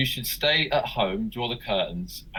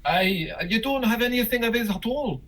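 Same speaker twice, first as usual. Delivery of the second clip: high, hammed-up Scottish caller; put-on Scot, pitched right up; bad Scottish accent; 11 semitones higher than usual. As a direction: query it in a terrible fake Scottish accent. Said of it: "that really bad Scottish accent".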